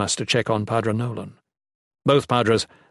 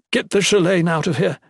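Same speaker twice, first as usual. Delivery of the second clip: high, loud order; commanding; 8 semitones higher, 5 dB louder than usual. Acted. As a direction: give a sharp command. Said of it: "Morel commanded".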